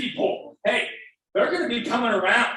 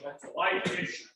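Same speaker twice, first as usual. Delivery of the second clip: getting emotional; 8 dB quieter than usual. Real, not acted. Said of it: "I'm sorry I'm getting emotional".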